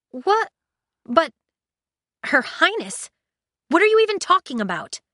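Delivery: high, fast, bewildered; shocked. Acted. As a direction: blurt out in disbelief. Shocked confusion.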